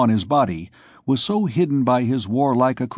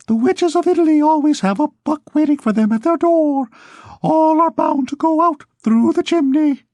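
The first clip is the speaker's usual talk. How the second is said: much higher falsetto Duke of Egypt, a little louder; 5 dB louder than usual. Acted. Duke of Egypt addressing his audience of thieves, in high falsetto. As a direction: lift into a reedy falsetto to address the crowd. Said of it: "in a falsetto voice".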